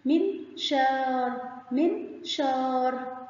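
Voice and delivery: "منشار" very slow, aa stretched out; long aa. Shown as a demonstration. Teacher sounding out a word for pupils, "منشار" with long aa. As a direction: draw out the "شا" inside "منشار". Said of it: "مد بالألف مع الشين".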